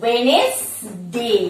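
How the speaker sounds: very slow Wednesday as wenes-day; two syllables. Shown as a demonstration. Teacher modelling it for students, two syllables. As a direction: stretch Wednesday into two parts, no middle D. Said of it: "also a two syllable word".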